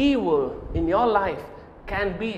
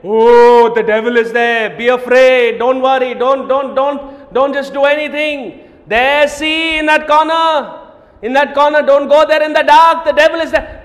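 mock fearful warning; 2 semitones higher, 8 dB louder than usual. Preacher mocking a God who spreads fear, hammed-up alarm. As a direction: play a jittery scaremonger. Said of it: "Never has the Lord said".

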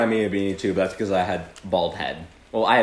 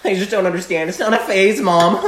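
put-on high whining teenager; whiny teen; 9 semitones higher, 4 dB louder than usual. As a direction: whine at mom like a moody teen.

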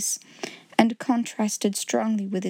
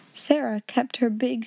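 usual speaking voice

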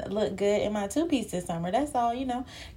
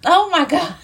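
laughing exclamation; 9 semitones higher, 7 dB louder than usual. Real, not acted. Laughs at her own story.

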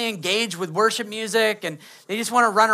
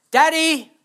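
put-on higher, louder call of a little girl; 6 semitones higher, 5 dB louder than usual. Imitating his daughter calling for daddy, higher and louder. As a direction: call out sweetly like a small child.

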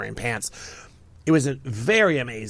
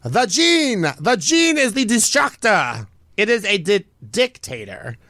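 put-on higher, louder dramatic accented pronouncement; slow, higher mock accent condemning jeans; mock Italian accent; 7 semitones higher, 4 dB louder, slower than usual. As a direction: declare it like an outraged couturier in a put-on accent. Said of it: "So here's his quote on the jean".

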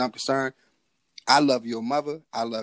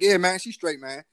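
irritated; 6 semitones higher than usual. Retold or imitated. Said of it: "So I got upset".

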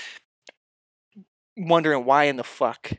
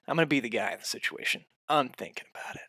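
ordinary delivery

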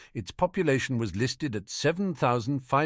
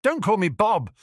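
higher, louder angry snap; snapping; 10 semitones higher, 5 dB louder than usual. Acted. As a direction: snap it back in anger. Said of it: "the werewolf snapped".

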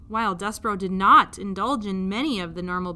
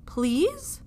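higher, coaxing "please"; pleading child voice; 6 semitones higher than usual. Acted. Princess begging the king, sweet plea. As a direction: beg sweetly. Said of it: "princess begs".

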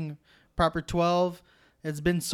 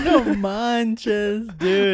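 laughing; 3 semitones higher, 5 dB louder, much slower than usual. Real, not acted. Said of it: "echoes the line, laughing".

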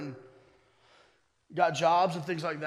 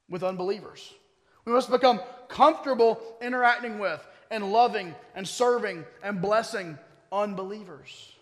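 loud, raised-pitch sermon delivery; impassioned preaching; 4 semitones higher, 8 dB louder than usual. Real, not acted. Pastor urging church to follow Jesus beyond the bubble, preaching hard. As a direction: drive it home to the congregation, loud and emphatic.

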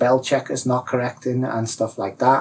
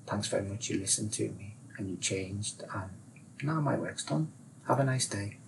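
much softer sign-off, lifting at the end; satisfied, departing advisor; 13 dB quieter than usual. Acted. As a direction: say a quiet, polite goodbye.

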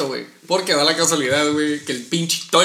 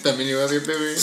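ordinary talk

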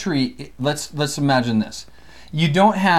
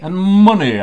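odd voice; 6 semitones higher, 9 dB louder than usual. Shown as a demonstration. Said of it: "I don't know why I'm talking like that".

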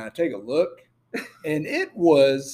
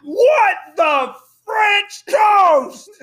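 much higher, slow outraged yell; wife yelling from upstairs in outrage; imitating a shout; 8 dB louder, much slower than usual. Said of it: "all of a sudden I hear".

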